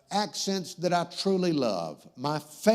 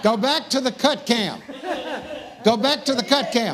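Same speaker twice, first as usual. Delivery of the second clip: raised, higher, insistent call; calling out orders; 5 semitones higher, 4 dB louder than usual. Real, not acted. Host calling to the crew to go back, raised voice. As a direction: call over the laughter to the crew. Host calls out a camera command.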